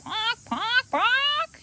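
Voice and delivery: high, squawky pawk pawk; rubber chicken squawk. Pretend. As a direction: cluck in agreement like a toy chicken. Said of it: "she made her rubber chicken agree".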